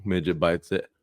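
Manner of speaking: ordinary delivery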